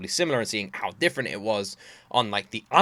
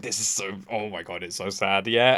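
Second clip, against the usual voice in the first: imitating giggling songwriter; 5 semitones lower than usual. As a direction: mumble it like a secret joke. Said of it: "just giggling".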